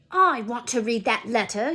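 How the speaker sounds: loud, demanding; loudly. Acted. Son loudly demanding to read the letter. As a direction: insist on it loudly. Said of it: "he said loudly".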